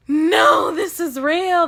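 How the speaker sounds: high, loud dramatic wail; acted panic. Handcuffed teen facing real life, panicked wail. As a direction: wail it in mock horror.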